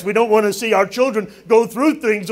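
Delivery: ordinary talk, nothing marked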